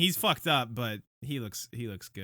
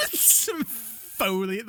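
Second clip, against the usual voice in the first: slow, high, giggling echo; laughing mimicry; 7 semitones higher, much slower than usual. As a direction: draw it out through a laugh. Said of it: "Why did you say it like that".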